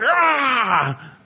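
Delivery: anguished wail of a grown man; hairy man's cry. Retold or imitated. Esau crying out to his father, a mock anguished wail. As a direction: cry out like the bitter, hairy brother. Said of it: "this hairy man going".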